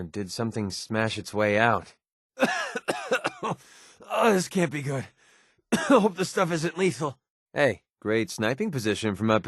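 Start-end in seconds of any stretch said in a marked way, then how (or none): 2.4-7.2 choked, higher voice between coughs; cough, then force the words out high. Acted. Coughing at possibly lethal stuff.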